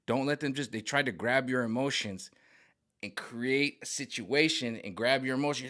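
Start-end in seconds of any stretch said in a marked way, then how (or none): none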